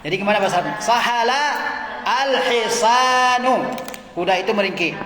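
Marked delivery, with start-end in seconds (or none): 0.8-3.7 Sahala al-hisanu drawn out, slowly; drawn-out classroom dictation. Shown as a demonstration. Teacher dictating answer slowly.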